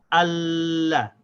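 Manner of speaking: ALLAH with a long l into a short la; unbroken lam. Shown as a demonstration. Teacher joining al and la, without stopping. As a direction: hold the l through ALLAH. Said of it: "stopping the sound of the lam".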